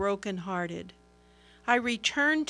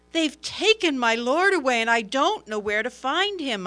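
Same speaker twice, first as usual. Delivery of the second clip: louder, higher, pleading through grief; grieving woman pleading with the angels, loud and anguished; anguished plea; 4 semitones higher, 7 dB louder than usual. Acted. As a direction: cry it out as if the loss is unbearable.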